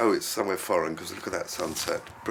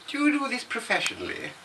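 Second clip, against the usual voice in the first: much higher mock voice of a sweet lady asking; imitating a lady. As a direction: pipe up high like the curious lady. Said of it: "you get questions like".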